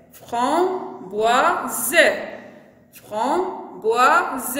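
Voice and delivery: "Framboise" broken into fram, boi, se; syllable by syllable. Shown as a demonstration. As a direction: sound out "Framboise" with gaps, voicing the final e. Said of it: "on a combien de syllabes?". (« Framboise » découpé en trois syllabes, fram-boi-se)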